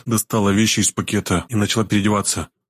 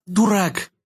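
put-on high-pitched girlish indignation; indignant girl; 11 semitones higher than usual. A narrator's reading. Girl to the healer who hushed her, indignant. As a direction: snap it back in a much higher voice. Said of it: "возмутилась девушка".

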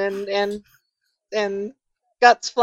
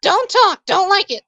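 high-pitched, stubborn character voice; grumpy shut-in; 8 semitones higher than usual. Acted. Resident refusing to talk through the door, high grumpy voice. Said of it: "you hear from the inside".

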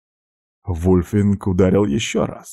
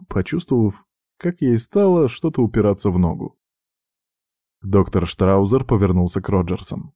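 ordinary delivery, nothing marked

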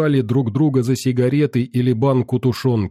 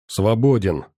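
barked order. Acted. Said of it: "рявкнул капитан".

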